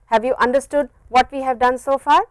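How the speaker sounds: ordinary talk, nothing marked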